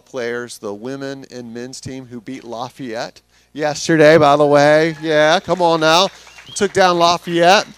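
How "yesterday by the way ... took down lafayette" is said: excited cheer. Real, not acted. Cheering on the basketball team.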